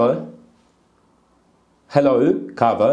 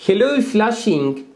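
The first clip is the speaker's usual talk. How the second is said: put-on slow, high, Chinese-accented English; 9 semitones higher, much slower than usual. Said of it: "А попробуй с китайским акцентом". (с китайским акцентом, медленно и высоко)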